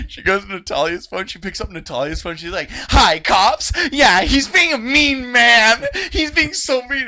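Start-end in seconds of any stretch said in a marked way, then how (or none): 2.7-7.1 put-on high, loud kid voice; imitated little girl. Small girl reporting a mean man to imaginary cops, high imitated complaint. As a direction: report him in a drawn-out child's voice.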